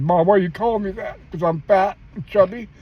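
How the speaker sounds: put-on high, hurt child's voice; a chubby kid afraid of the answer, voiced high; hurt little boy. A young son asking his mom about a nickname, high and hurt.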